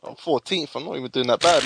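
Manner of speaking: ordinary talk, nothing marked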